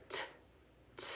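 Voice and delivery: t released into a breath of air; t with the air let out; slow t. Shown as a demonstration. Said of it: "stop and just let the air out".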